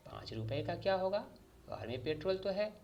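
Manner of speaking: a son mocking his father's stingy refusal, huffy and high; petulant imitation of father. A narrator's reading. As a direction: throw father's own words back, pouting and high. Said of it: "Arif ने तुनक कर अब्बा जान की नकल उतारी".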